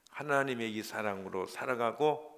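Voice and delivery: ordinary delivery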